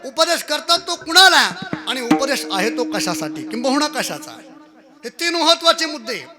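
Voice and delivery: high, loud kirtan oratory; ringing, declamatory sermon; full-force preaching. Real, not acted. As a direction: proclaim the verse's points to a big congregation.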